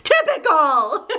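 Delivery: slow amused drawl; laughing, drawn out. Real, not acted. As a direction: laugh through it. Playful laughing comment.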